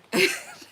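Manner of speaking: high, loud burst of laughter; real amused cackle. Real, not acted. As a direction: burst out laughing at your own story. Laughs at being inspired by film.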